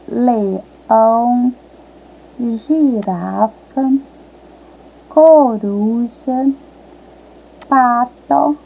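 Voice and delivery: "leão, girafa, coruja" stretched syllable by syllable; sounding out words. Shown as a demonstration. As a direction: slowly draw out each name in "leão, girafa, coruja, pato, sapo e foca". Teacher sounds out animal names.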